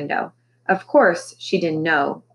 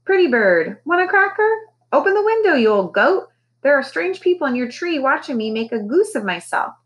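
very high squawky Polly; fake parrot squawk; 8 semitones higher than usual. A narrator's reading. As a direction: ham up dumb bird talk. Parrot at window.

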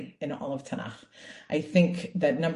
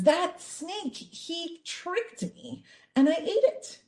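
much higher, whiny excuse voice; blame game; 12 semitones higher, much slower than usual. Acted. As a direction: shift the blame onto the snake. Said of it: "She says".

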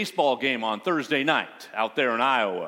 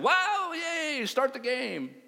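mock fan cheer; 9 semitones higher, slower than usual. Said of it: "or they said".

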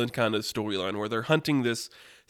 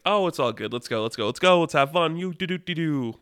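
jolly showman; 4 semitones higher than usual. Acted. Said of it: "just being the jolly good showman he is".

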